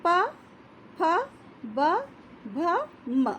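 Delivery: प, फ, ब, भ, म each clipped apart; slow letter demo. Shown as a demonstration. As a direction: sound प फ ब भ म one at a time. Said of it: "आप इनका उच्चारण कीजिए". (होठों से धीरे-धीरे उच्चारण)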